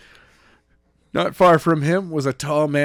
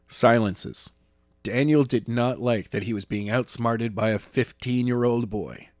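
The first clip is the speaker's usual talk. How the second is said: ordinary delivery, nothing marked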